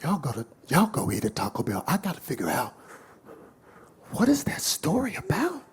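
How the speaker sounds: hushed, puzzled muttering; obsessed hushed voice. Retold or imitated. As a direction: whisper as if obsessed with the riddle. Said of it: "He's like".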